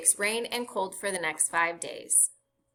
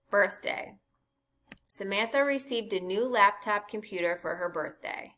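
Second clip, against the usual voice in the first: ordinary delivery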